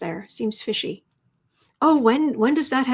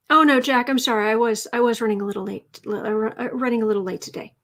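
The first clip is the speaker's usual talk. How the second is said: ordinary delivery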